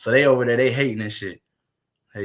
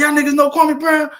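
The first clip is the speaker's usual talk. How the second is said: much higher and louder; excited question; 12 semitones higher, 5 dB louder than usual. Retold or imitated. Streamer asking strangers about Kwame Brown, excited and high. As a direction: ask it high, hyped.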